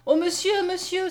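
high, urgent exclamation; crying out. A narrator's reading. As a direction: call out anxiously for help, pitched high. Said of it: "s'écria Mariette". (s'écrier, voix aiguë et pressante)

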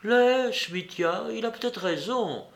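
high, subdued and slightly strange. A narrator's reading. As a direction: gently hold him back in an odd tone. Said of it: "dit Glouchenka d'une voix étrange".

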